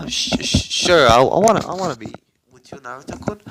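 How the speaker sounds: acted nervous acceptance, tailing down at the end; flustered stammered yes. Shy yes to being asked out.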